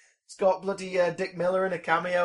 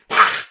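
creature pah; 2 semitones higher, 10 dB louder than usual. Retold or imitated.

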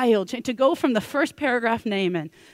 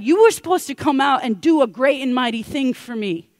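loud, higher indignant protest; ticked off; 4 semitones higher, 7 dB louder than usual. Acted. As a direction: complain like an affronted big shot. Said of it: "you can tell Naaman is ticked".

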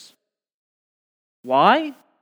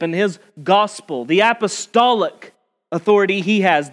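usual speaking voice

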